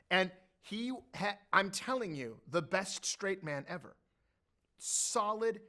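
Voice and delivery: ordinary talk, nothing marked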